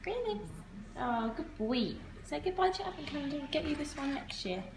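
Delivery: gently cooing to the dog; pet talk. Real, not acted. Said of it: "Why do you always talk like that to, like, pets?".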